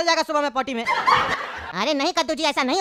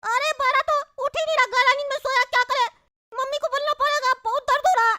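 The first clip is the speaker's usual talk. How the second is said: put-on high-pitched whining child voice; squeaky kid complaining of a bellyache; high, plaintive cartoon child; little boy in pain; 11 semitones higher than usual. Child character with stomach ache.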